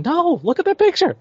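high-pitched, incredulous correction; exasperated objection. Acted. Presenter rebutting the tool that misread a sarcastic post, exasperated objection. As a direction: protest it, pitch leaping way up.

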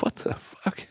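hushed, breathed-out curse under the blanket; whispered curse. Retold or imitated. Dad hiding from his son, exasperated whisper. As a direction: mutter it so the son can't hear.

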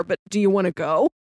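ordinary delivery

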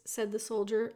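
ordinary delivery, nothing marked

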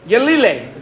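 name the sound by chanting it; a light demo of the ululation; ululation call. Shown as a demonstration. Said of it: "That's what they call it".

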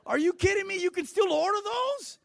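hammed-up shock at a checkbook; mock disbelief. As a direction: shopper stunned by a checkbook, loud high disbelief. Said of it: "You're like".

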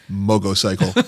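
put-on mogocycle said with a g for the t, like a little kid; childish mispronunciation. Recalling his little brother saying mogocycle. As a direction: say mogocycle the way a small boy mangles motorcycle. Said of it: "he would always say".

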